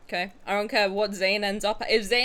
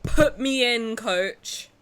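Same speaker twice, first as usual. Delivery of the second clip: loud; 2 semitones higher, 6 dB louder, much slower than usual. Retold or imitated. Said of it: "I was like".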